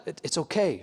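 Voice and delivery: put-on hushed, soothing a frightened child; calm the boy down gently; a father hushing his son. Isaiah comforting his son in courtroom.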